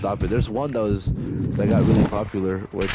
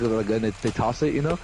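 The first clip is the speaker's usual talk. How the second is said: ordinary talk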